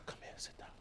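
hushed, voiceless whisper. Shown as a demonstration. Preacher showing which one you'd hear better, whispered. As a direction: whisper the order almost inaudibly. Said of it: "But the volume changed".

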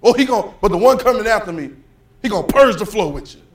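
loud, high shouted sermon climax; preaching at full force. Acted. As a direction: preach it hard, full volume.

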